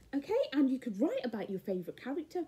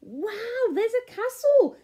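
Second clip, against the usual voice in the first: plain reading voice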